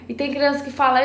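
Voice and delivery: usual speaking voice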